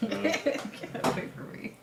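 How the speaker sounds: high laughing squeal; laughing tease. Pretend. Teasing partner getting up stiffly.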